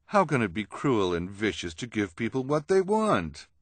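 theatrical villainess protesting; indignant schemer. Acted. Esme challenges the objection.

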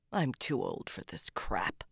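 low, husky near-whisper; weary mutter, dropped in pitch and volume. A narrator's reading. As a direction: grumble it quietly under your breath. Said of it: "he muttered".

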